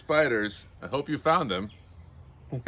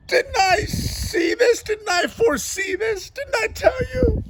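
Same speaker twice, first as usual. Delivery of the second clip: high, wailing mock-tragic yell. Astrologer bursting into the bedroom to lament, yelling. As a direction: cry out in melodramatic despair, easing toward the end.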